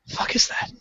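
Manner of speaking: unvoiced alarmed whisper; whispered worry. Hiding in the pool at night, scared whisper. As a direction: hush it, spooked.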